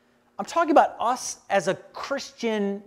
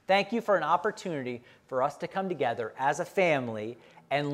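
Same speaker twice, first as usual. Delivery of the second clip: closing prayer; 4 semitones lower than usual. Acted.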